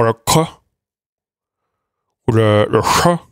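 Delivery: "ka" and "cha" done as clicks, the tongue sealed and then released suddenly; click demo. Shown as a demonstration. Teacher demonstrating click consonants to students. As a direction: pop the "ka" as a click. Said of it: "Le que serait faire le ka".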